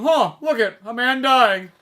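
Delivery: lightly caricatured rich bystanders, loud and high; rich onlookers. Acted. Rich bystanders gawking at a dying man, mock loud amazement. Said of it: "Bunch of rich people going".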